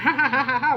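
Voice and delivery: mock laugh, sarcastic; retold sarcastic laughter. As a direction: fake ha-ha laugh. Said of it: "I was just like".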